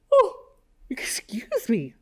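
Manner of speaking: words stretched through a yawn; slow apology yawning. Real, not acted. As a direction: yawn out an excuse me. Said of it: "That yawn snuck out of nowhere".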